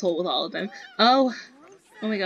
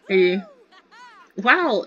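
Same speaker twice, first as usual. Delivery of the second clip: usual speaking voice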